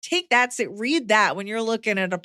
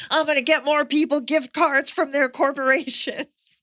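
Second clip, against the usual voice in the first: scheming mock voice; 6 semitones higher than usual. Mock scheming self quote.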